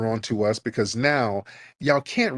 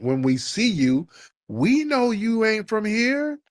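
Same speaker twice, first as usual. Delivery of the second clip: ordinary talk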